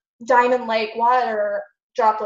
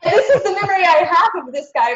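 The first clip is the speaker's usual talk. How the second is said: words bubbling through real laughter; laughing while talking; 6 semitones higher, 7 dB louder than usual. Real, not acted. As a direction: laugh it out, still amused by the memory. Laughing at leprechaun cloud memory.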